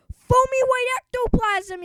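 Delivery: high, loud scream of fright; screaming girls in panic; terrified shriek. Acted. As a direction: cheerleaders shrieking at the foam. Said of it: "yelled the cheer-, cheerleaders".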